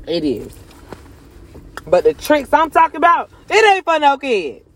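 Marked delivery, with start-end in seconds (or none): 1.9-4.6 yelling. Real, not acted. Yelling at the audience about the tricks, then apologizing to her son. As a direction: shout it out to make the point. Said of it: "I yelled".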